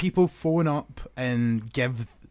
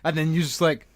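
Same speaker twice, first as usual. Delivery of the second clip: ordinary talk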